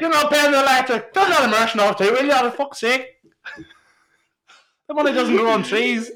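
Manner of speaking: high, loud nagging mam; nagging parent. Acted. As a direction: give out like a fed-up parent. Parent scolding about electricity bill.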